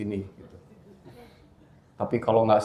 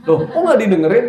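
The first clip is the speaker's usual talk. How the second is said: shocked father; 12 semitones higher, 13 dB louder than usual. Retold or imitated. Father's shocked reply, retold.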